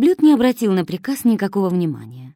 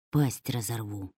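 low, softer menacing threat; irritated growl; 8 semitones lower, 8 dB quieter than usual. A narrator's reading. Dotsent snapping at Kosoy, low and irritated. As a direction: threaten lazily, annoyed. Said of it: "с раздражением отозвался доцент".

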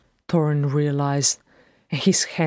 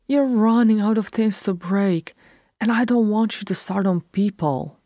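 higher-pitched pleading grumble; moaning complaint; 6 semitones higher than usual. A narrator's reading. Freyrin fretting over Thorin's breakages, high moaning plea. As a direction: complain in a raised, plaintive pitch. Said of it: "Freyrin moaned".

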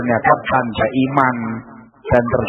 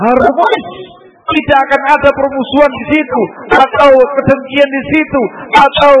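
fervent preaching; 11 semitones higher than usual. Acted. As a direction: preach it with swelling fervor. Preacher builds to full force.

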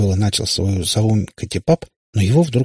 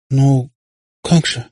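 higher, halting protest; bewildered babble; 6 semitones higher, 4 dB louder, much slower than usual. A narrator's reading. Katya keeps protesting to the witcher, babbling in confusion. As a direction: falter in helpless confusion. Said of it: "продолжала лепетать Катя".

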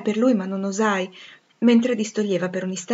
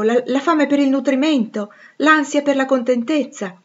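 passionate protest; 7 semitones higher, 5 dB louder than usual. Acted.

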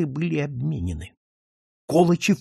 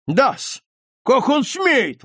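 high, indignant outburst; hot-headed indignation, pitched up; heated exclamation; 10 semitones higher, 4 dB louder, much slower than usual. A narrator's reading. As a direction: exclaim it hotly, drawing out the words. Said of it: "воскликнул горячий нотариус".

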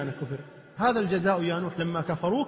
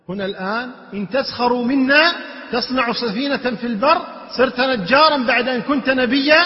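higher, louder storytelling sermon; impassioned preaching; 6 semitones higher, 6 dB louder than usual. Acted. Preacher retelling the flood to listeners, impassioned. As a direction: preach it with force and emphasis.

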